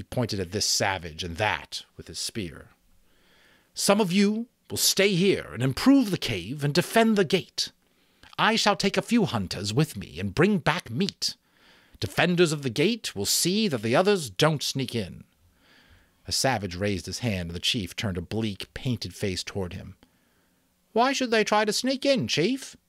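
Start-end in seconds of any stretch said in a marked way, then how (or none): 3.7-15.2 higher, louder commanding voice; chief giving orders. Acted. Chief gives orders to tribe. 20.9-22.8 put-on much higher, puzzled boy's voice; questioning savage boy.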